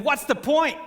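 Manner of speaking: high, loud heckle from the crowd; shouted heckle. Retold or imitated. Heckler shouts up at the speaker, high and loud. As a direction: yell it at the stage. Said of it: "he shouted".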